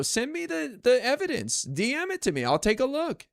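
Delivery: pitched well up, breezily asking for proof; mock casual request. Streamer mimicking his stock reply to accusers, high and mock-casual. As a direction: act the unbothered guy asking for receipts. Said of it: "I go".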